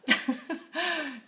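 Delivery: high bright laugh. Real, not acted. Laughing at colleague's hat joke. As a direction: burst out laughing.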